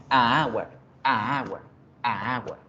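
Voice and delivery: "a agua" with "a" cut off from the word hour; unnatural vowel clash. Shown as a demonstration. Teacher showing the wrong article before hour, unnatural "a agua". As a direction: say "a agua" haltingly, as two separate vowel sounds. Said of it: "No suena natural".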